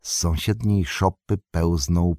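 ordinary delivery, nothing marked

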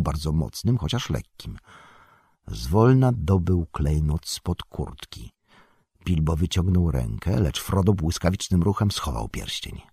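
ordinary delivery, nothing marked

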